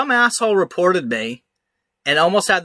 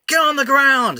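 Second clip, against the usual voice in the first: much higher mock cop yell; cop order; 11 semitones higher than usual. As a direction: command him down to the ground, imitating the cop. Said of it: "And they're like".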